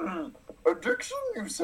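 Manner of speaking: put-on high, small wise-old-master voice; a questioning Yoda; Yoda impression. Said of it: "fun to talk like Yoda".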